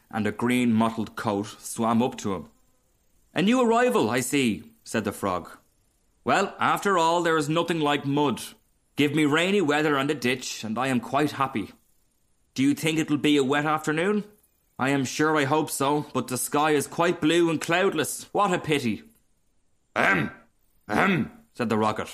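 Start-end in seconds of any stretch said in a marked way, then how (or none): 3.3-4.6 chatty frog. Acted. Voices the frog. 6.2-19.0 higher-pitched, contented frog chatter; chatty frog. Acted. Frog greeting a new arrival in the ditch, chatty. 19.9-21.3 higher, falling 'ahem', twice over; pointed throat-clearing. A narrator's reading. Rocket trying to interrupt the frog, coughing pointedly. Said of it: "he began to cough".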